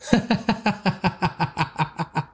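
high, wild cackle with no words; lunatic laugh. Acted. Raju losing his mind laughs at Tarek, lunatic cackle. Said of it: "Raju laughed like a lunatic".

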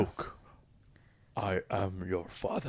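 low, hushed, drawn-out villain line; Darth Vader. Acted. Guest quotes the movie villain's famous line, deep quiet villain voice. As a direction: drop deep and slow, very quiet. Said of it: "when Darth Vader tells him".

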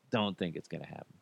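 a quick muttered repeat to yourself; muttered aside. Acted. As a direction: mumble it under your breath fast. Repeats the line, second muttered.

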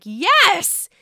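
high, loud approving cheer; gleeful cheering. Retold or imitated. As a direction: egg her on with relish. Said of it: "I'm like".

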